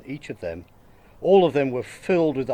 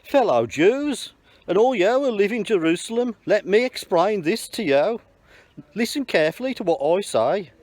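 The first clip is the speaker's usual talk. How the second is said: higher, calling out to the whole crowd; raised voice; 8 semitones higher than usual. Acted. Peter addressing the crowd in Jerusalem, voice raised high. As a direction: rouse them to listen. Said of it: "raised his voice and addressed the crowd".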